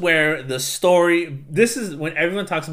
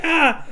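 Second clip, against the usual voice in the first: high yelp of effort; a sudden high battle cry; mock yelp. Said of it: "she's like".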